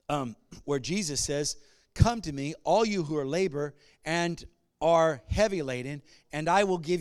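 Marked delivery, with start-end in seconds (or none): 1.9-7.0 loud, impassioned scripture quote; fervent preaching. Acted. Preaching scripture at full force.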